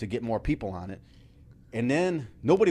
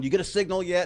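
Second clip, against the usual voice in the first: higher, louder mock call-down; retold yell; 8 semitones higher, 6 dB louder than usual. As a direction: imitate calling down from a rooftop. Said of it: "I'm yelling down".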